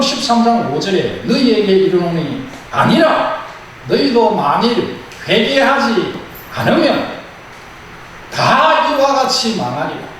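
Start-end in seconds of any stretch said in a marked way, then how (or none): 1.2-10.1 slow, weighty scripture quote; solemn scripture reading. Acted. Preacher slowly quoting Jesus's warning to the congregation. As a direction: read the verse slowly and gravely.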